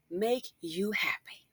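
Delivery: slow, voiceless whisper of Make you happy. Real, not acted. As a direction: breathe it out slowly as a secret aside. Aside to the woman.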